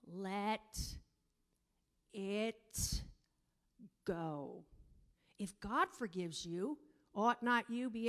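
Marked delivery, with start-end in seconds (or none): 0.1-4.7 1 slow drawn-out refrain. Acted.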